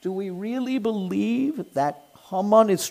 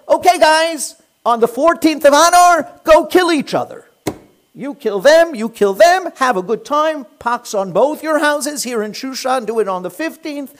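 loud, higher, breezy mock decree; careless loud king; 6 semitones higher, 7 dB louder than usual. The king breezily telling his subjects to fight, loud careless mock decree. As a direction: toss it off like a bored tyrant.

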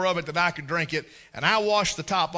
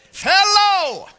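much higher and louder, a mock yell; shouted hello; 12 semitones higher, 10 dB louder than usual. A boy yells hello at the foreigner, mock shout. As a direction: belt out hello as if volume helps understanding. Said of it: "you just speak louder".

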